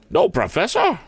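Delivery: put-on high-pitched, indignant old maid principal. A narrator's reading. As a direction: cry it in affronted headmistress tones. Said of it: "cried Miss Perkman indignantly".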